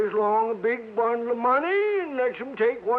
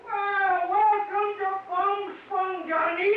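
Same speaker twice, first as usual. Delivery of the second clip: whooping welcome; 7 semitones higher, slower than usual. Acted. Old cowboy greets Johnny, whooping.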